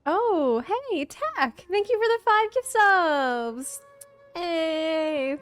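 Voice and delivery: loud, high, drawn-out cheer; excited thanks. Real, not acted. Streamer thanks a viewer for five gift subs, excited. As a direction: gush thanks with long, stretched words.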